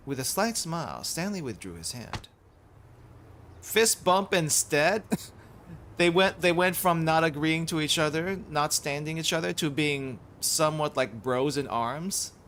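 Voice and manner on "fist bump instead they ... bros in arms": excited. Real, not acted. Reader reacts to the scene.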